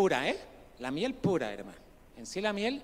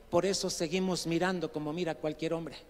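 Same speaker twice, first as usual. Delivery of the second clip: ordinary delivery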